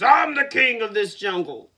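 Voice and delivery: with attitude. Acted. Lion boasts to the rabbit with loud attitude. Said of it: "looked up at him and says".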